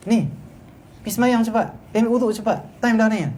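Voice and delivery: high, urgent warden's commands; cross ordering. Acted. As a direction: order dorm boys to prayer crossly. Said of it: "budak yang kita suruh dia kena marah". (arahan warden yang marah)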